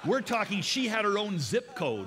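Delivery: loud, high, laughing exaggeration; over-the-top comic buildup; excited build. Real, not acted. Comic exaggeration of huge seatmate.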